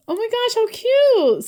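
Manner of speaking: high, gushing exclamation; delighted squeal. Real, not acted. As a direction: gush over it, pitched right up. Delighted reaction to cute stickers.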